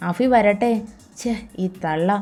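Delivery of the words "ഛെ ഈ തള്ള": low, soft grumble under the breath; muttered aside. A narrator's reading. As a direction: mutter it so she can't hear. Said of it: "നിധി പിറുപിറുത്തു".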